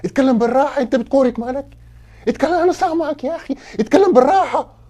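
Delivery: loud, high-pitched demand for calm; losing patience, voice jumping up loud; exasperated shouting. Acted. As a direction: yell at them to speak calmly. Exasperated, tells guest to calm down.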